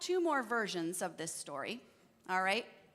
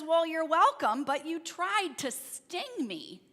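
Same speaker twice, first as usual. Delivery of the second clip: higher, louder mock indignation; pointed accusation; 4 semitones higher, 5 dB louder than usual. Frog calls out the scorpion after crossing, indignant and raised. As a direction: start loud and high, then stretch out the accusation.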